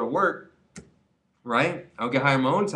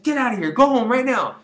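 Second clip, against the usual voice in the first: loud, much higher shout to leave; angry order; 11 semitones higher, 6 dB louder than usual. Retold or imitated. General manager kicking the employee out, loud angry shout. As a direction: throw him out, shouting.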